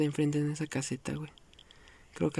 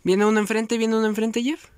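higher, louder warning to teammates; urgent callout; 5 semitones higher, 5 dB louder than usual. Real, not acted. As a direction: call it out fast and loud.

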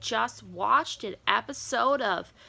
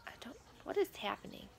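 baffled whisper; 10 dB quieter, faster than usual. Real, not acted. Baffled by the dance video.

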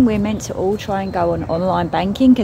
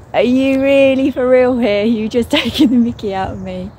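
high, loud, laughing through the words; half-laughing at being teased by the card; laughing disbelief; 5 semitones higher, 6 dB louder than usual. Retold or imitated. Laughing at the angels' cheeky card. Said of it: "it was hilarious".

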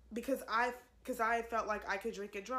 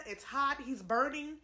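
ordinary talk

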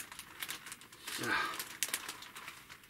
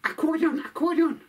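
high, louder excited dog voice; 12 semitones higher, 4 dB louder than usual. Acted. Dog Fido telling his friend about the theft. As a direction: bark the word out urgently. Dog tells what was stolen.

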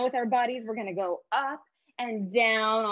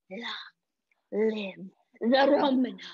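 low villain voice; 4 dB louder than usual. Shown as a demonstration. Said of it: "say in a low voice".

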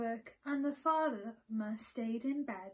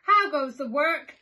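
farmer calls out loudly from rocking bed; startled loud call; 4 semitones higher, 14 dB louder than usual. A narrator's reading. Farmer woken by the rocking bed, calling out loudly. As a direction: shout it, woken and alarmed. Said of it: "he called".

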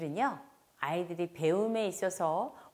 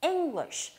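"English" high on ENG, falling sharply away; first-syllable stress; 7 semitones higher than usual. Shown as a demonstration. Teacher showing where the accent goes, ENG stressed in "English". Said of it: "첫 번째 강세 주셔야 되고".

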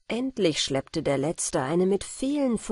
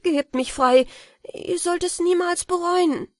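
much higher and louder, begging to be freed; begging cry; 11 semitones higher, 4 dB louder than usual. A narrator's reading. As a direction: plead urgently for freedom. Frightened boy begs release.

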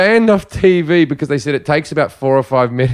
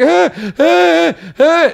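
high mock squeals; imitated squirming squeals; 13 semitones higher, much slower than usual. Teller mimicking woman squirming from grabbing men, high squeals. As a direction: squeal as if wriggling free.

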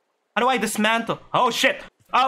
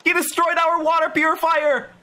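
higher, sudden dismay; shocked outburst; 6 semitones higher than usual. Real, not acted. Player dismayed as the shark breaks the purifier. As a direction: react like it just got wrecked.

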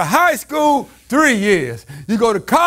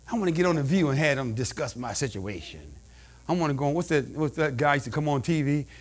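much lower, softer, fast side remark; quiet aside; 10 semitones lower, 9 dB quieter than usual. Acted. Preacher muttering a fast aside to the church. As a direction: drop into an offhand mutter.

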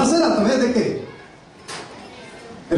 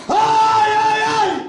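screaming; 13 semitones higher, much slower than usual. Retold or imitated. Preacher voicing martyrs screaming in the fire. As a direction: cry out like burning martyrs.

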